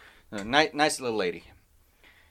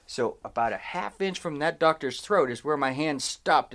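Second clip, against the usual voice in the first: ordinary delivery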